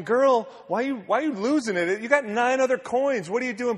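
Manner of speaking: high, loud, incredulous sassy voice; mock scolding onlooker. Mocking onlooker scolding the woman.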